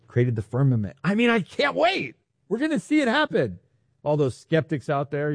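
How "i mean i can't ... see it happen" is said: high-pitched, giddy anticipation; eager excitement. Real, not acted. Preacher telling congregation they will witness creation, eager excitement.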